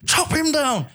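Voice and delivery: excited mock trainer. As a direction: fire him up like a trainer. Quoting trainer in fight scene.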